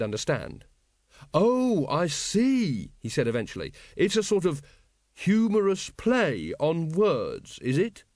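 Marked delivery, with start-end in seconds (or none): 1.3-2.9 high, drawn-out dawning realisation; earnest puzzlement. A narrator's reading. Earnest recruit finally getting a joke, puzzled. 4.0-8.0 higher, slow, careful working-out; earnest puzzlement. A narrator's reading. Earnest recruit checking a drunk friend's joke, puzzled.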